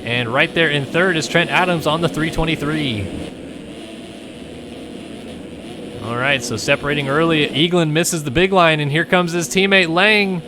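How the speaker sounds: live play-by-play. Acted. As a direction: call the race with rising excitement. Excited race play-by-play.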